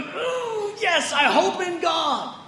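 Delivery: hammed-up joyful shout; triumphant cry. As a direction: burst out in sudden hope. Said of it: "And then you go".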